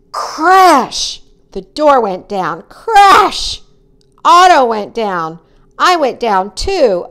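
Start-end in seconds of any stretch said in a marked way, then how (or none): 0.1-1.2 high, punchy sound-effect word; exclaimed crash. A narrator's reading. Boy telling how the door went down, exclaiming. 2.6-3.6 high, loud sound-effect word; exclaimed crash. A narrator's reading. Boy telling how fish and door crashed, loud exclamation. Narrator voices second crash.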